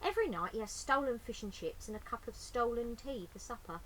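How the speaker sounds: ordinary delivery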